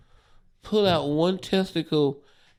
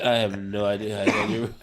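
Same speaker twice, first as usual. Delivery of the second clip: put-on laughing drunk quote; 8 semitones lower, faster than usual. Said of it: "And he said".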